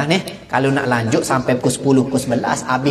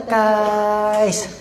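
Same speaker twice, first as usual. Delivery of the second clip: questioning friend; 10 semitones higher than usual. Retold or imitated. Voicing friend questioning Kais.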